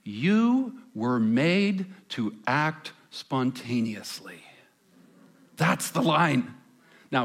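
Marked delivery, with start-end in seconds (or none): 0.0-4.4 slow, hushed, persuasive commercial voice; suggestive ad voiceover. Retold or imitated. Pastor imitating the Expedia ad voice to the congregation, slow and suggestive. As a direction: pitch the line slowly like a seductive travel ad. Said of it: "Here's what Expedia preaches to the world".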